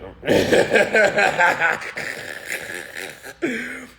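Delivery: loud, long, high cackle; mocking cackle. Real, not acted. Streamer cracking up at husbands' fibs, loud mocking laugh. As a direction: burst out laughing at your own joke.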